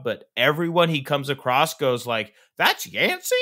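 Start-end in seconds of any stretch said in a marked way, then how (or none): 2.6-3.3 high, surprised mock reaction; incredulous question. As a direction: act out amazed disbelief, pitched high. Everyone's reaction to meeting Yancey, acted.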